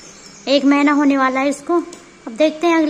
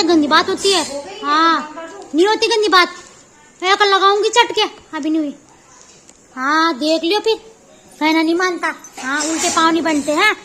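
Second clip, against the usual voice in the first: usual speaking voice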